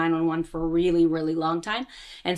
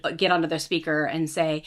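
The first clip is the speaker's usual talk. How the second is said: normal talking voice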